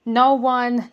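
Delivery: usual speaking voice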